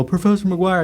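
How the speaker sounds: students calling out. Retold or imitated. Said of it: "they go".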